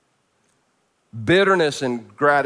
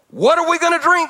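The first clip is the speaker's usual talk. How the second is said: high, raised-voice demand; 13 semitones higher, 4 dB louder than usual. A narrator's reading. Thirsty people turning against Moses, demanding. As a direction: cry it out like a panicked complaint. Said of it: "they demanded".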